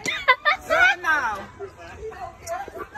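high laugh. Real, not acted. Laugh after horrible answer.

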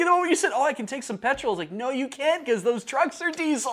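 loud, high-pitched protest; exasperated nitpicking at full volume; indignant objection. Real, not acted. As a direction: blurt out the flaw, worked up. Indignant objection to the book's error.

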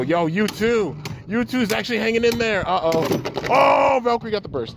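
high excited play-by-play. Real, not acted. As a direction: call the upset, pitch jumping up. Excited battle commentary, big upset.

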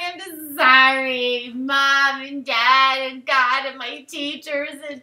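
put-on over-the-top teenage despair, begging; hammed-up sobbing apology. Melodramatic diary apology, mock crying.

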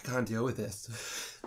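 slow, soft, defeated; miserable. Real, not acted. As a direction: drag it out in misery. Suffering from spicy burn.

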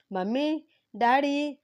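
high, loud call through the house; calling out loudly. A narrator's reading. Son summoning the whole family, calling out loud. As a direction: call out for mom and dad across the house. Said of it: "అని గట్టి గట్టిగా అరుస్తూ ఉన్నాడు".

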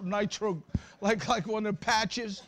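ordinary talk

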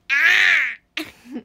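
very loud, harsh cawing cry; crow caw. Retold or imitated. Streamer showing chat her crow imitation, loud caw. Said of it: "めっちゃカラスに似てる".

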